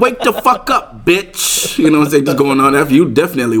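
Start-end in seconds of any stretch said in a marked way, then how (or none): 0.0-1.8 high, drawn-out mock wake-up call; imitated wake-up call. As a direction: imitate a rude alarm-clock voice, stretched and high. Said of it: "time to wake up".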